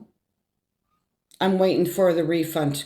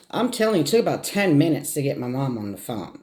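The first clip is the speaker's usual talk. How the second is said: usual speaking voice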